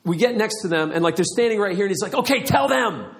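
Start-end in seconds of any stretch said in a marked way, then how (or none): 2.1-3.2 high, drawn-out eager prompting; super excited. Retold or imitated. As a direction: imitate an overexcited friend egging you on. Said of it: "He was super excited, a little over the top".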